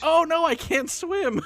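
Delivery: high-pitched quote breaking into laughter; laughing quote. Retold or imitated. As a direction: crack up while imitating the movie line, voice climbing high. Quotes favorite film line, cracking up.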